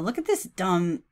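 usual speaking voice

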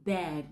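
"bag" said as beg with short e and a light final g; beg demo. Shown as a demonstration. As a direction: say "bag" with a narrow mouth, beg vowel. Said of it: "chúng ta đọc là".

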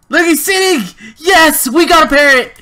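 very high, loud cry of triumph; elated shout. Real, not acted. Gamer sees the parrot finally sitting, elated shouting. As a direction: yell it in sheer excited victory.

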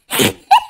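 a high, real burst of laughter. Real, not acted. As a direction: crack up at your own joke. Laughs at his own rant.

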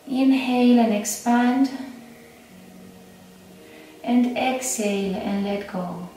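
unhurried meditation guide, long pauses between phrases; guided meditation. Acted.

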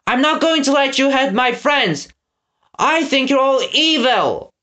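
high, defiant call. Acted. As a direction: stand up to the villains in a high voice. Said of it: "Eggs called defiantly".

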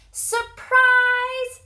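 high, loud, held-out cry; joyful shout. A narrator's reading. Mole revealing the boat, a high sustained shout. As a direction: shout it out, stretching the last syllable. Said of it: "shouted Mole".

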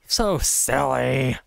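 slow, stretched-out teasing; playful mock chiding. Player belittles the screaming attackers, playful mock chiding. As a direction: draw out both words indulgently. Mock scolding of game enemies.

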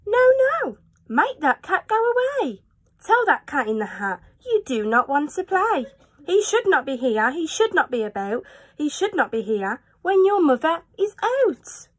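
high panicky fish protest; fussy fish. Acted. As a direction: fret like a nervous little fish. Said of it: "But our fish said".

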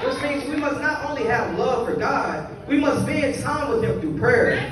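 ordinary talk

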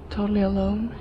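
ordinary talk

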